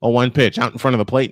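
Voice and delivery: ordinary talk, nothing marked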